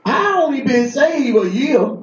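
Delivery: high, slow, incredulous; stunned disbelief. Shown as a demonstration. Telling the church how he answered the pastor, in disbelief. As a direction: say it like you can't believe it. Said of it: "That's how I said it, just like that".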